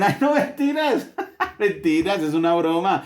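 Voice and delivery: high, laughing mock of a friend brushing it off; hammed-up dismissive laugh-voice; mocking excuse. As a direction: sneer it off as just a joke, giggling. Mocking excuse after cruel joke.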